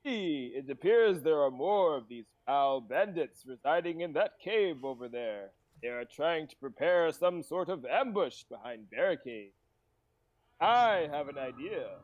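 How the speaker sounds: put-on slow, theatrical old-man delivery; stately, swooping storyteller's cadence; feisty old adventurer. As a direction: play the self-important old hero. Plays Leroy.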